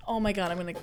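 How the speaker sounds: ordinary talk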